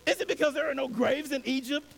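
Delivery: high, frantic pleading of scared people; the Israelites panicking and blaming Moses; panicked complaint. Acted. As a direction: cry out in alarm to Moses. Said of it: "were terrified and cried out".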